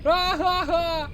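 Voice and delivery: high, loud villain cackle; evil laugh. Pretend. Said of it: "you should do an evil laugh".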